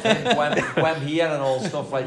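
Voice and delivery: Gwem said high and loud through a laugh, drawn out; playful put-on of Gwem with a giggle; mock local slang. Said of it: "getting used to all these Gwens".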